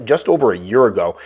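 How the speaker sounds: ordinary talk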